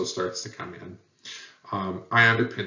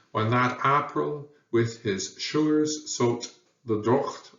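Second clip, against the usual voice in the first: slow, higher Middle English approximation, shures and docht; Middle English reading; 3 semitones higher, slower than usual. Shown as a demonstration. Teacher reading Chaucer's original aloud in Middle English. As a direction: read Chaucer slowly in reconstructed Middle English sounds. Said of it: "Might sound something like this".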